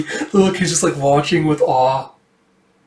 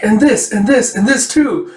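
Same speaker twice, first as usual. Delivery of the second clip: higher, louder, piling on; eager offering; 8 semitones higher, 4 dB louder than usual. Retold or imitated. Amir piling gifts on Karluk, eager and high. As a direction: keep pushing more on him.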